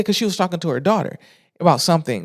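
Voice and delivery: normal talking voice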